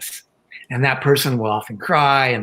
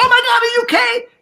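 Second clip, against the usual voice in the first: mock-panicked shriek, much higher; hammed-up alarm over a fallen child; panicked grandma; 5 dB louder than usual. Grandma rushing to fallen granddaughter, mock panicked high shriek. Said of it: "if I was to run over and go".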